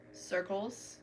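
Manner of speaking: ordinary talk, nothing marked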